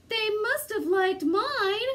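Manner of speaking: high little bear voice; cried out. A narrator's reading. Baby Bear holding out his empty bowl, high and childlike. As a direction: squeak it out like a small cub. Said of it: "cried Baby Bear".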